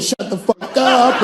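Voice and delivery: high, drawn-out put-on voice; defiant retort. Women's new defiant phrases, acted.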